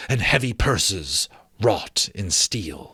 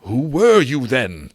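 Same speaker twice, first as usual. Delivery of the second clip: louder, higher demand; raised voice; 4 semitones higher, 5 dB louder than usual. Acted. Scrooge demanding the ghost's name, raised voice. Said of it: "raising his voice".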